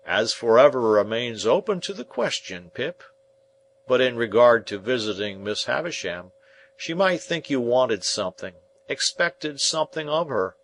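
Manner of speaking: a hearty, homely smith; Joe the blacksmith. Acted. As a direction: speak as plain, plodding Joe, weighing every word.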